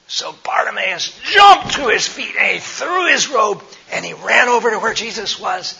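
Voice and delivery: loud, high, breakneck excitement in the telling; excited storytelling. A narrator's reading. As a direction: tell it with a burst of energy. Excited narration of the beggar leaping up.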